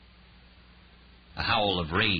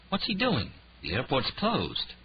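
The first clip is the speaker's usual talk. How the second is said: incredulous; 6 semitones higher than usual. Acted.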